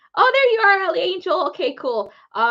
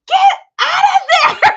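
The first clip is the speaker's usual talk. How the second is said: friend's shout; 13 dB louder, slower than usual. Retold or imitated. Friend yelling warning from afar.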